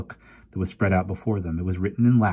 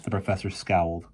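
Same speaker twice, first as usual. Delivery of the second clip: plain reading voice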